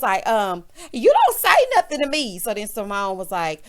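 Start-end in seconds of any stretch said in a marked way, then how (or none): 0.9-2.4 loud, high mock warning; imitated angry snap. Housewife warning off her rival, loud mock outburst. Heavenly snaps in retold quarrel.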